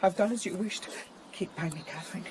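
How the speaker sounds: soft, meek plea fading away; cowed. Acted. Frightened son obeying his angry father, cowed. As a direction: give in quietly, frightened of him.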